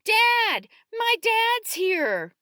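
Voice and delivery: high, loud, stretched-out cry for dad; shrieked call, words drawn long; child's yell. A narrator's reading. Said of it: "Malcolm yells".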